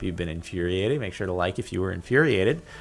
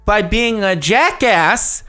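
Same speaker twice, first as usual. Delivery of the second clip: much louder and much higher, building to a furious yell; outraged owner rant; 12 semitones higher, 8 dB louder than usual. Retold or imitated. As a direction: rail at the reviewer like a wronged owner. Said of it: "I'd be like".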